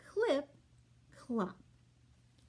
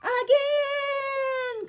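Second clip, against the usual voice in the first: much higher loud shout; gleeful cry; 13 semitones higher, 7 dB louder than usual. Acted. Animals cheer to ride again, high and loud. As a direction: cheer for another go. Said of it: "cry cat".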